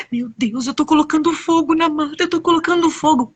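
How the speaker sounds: mildly panicked character voice; dismayed realisation. Acted. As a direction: pitch climbing in acted panic. Little macaw realises her mistake.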